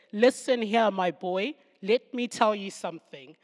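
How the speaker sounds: stern, loud Southern African mother; Southern African twang. Acted. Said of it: "if she throws in the Southern African twang".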